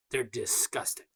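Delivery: ordinary talk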